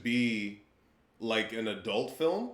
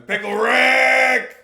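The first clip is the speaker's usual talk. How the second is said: slow, high, loud cartoon shout; Pickle Rick; 12 semitones higher, 12 dB louder, much slower than usual. Riffing on Rick and Morty with co-host, slow high cartoon shout. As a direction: belt it like the cartoon scientist.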